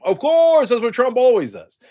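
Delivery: loud exasperated refrain. Real, not acted. Exasperated refrain about Trump.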